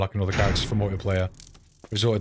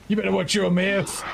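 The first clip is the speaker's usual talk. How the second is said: put-on American accent; 9 semitones higher than usual. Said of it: "American accent?".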